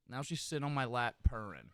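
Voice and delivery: normal talking voice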